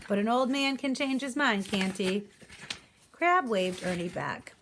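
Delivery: ordinary delivery, nothing marked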